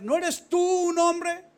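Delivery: slow, high and loud, calling across the hills; loud mocking challenge from afar; shouted taunt. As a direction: bellow the reproach at Abner. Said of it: "Y dijo David a Abner".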